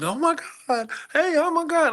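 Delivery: high, flustered mock gushing; starstruck manager. Theater manager starstruck at the star, high gushing. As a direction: gush like a shaking fan. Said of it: "the manager's all shaking".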